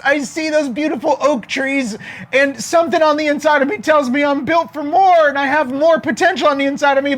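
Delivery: high, whiny put-on voice; tearful acorn. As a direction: plead like a small sad acorn. Acorn pouring out heart.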